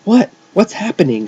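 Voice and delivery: high, bewildered question; confused. A narrator's reading. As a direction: ask it loudly in confusion.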